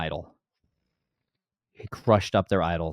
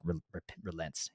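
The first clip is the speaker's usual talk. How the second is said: whisper; 2 semitones lower, 14 dB quieter than usual. Real, not acted. Whispered aside on Yahweh.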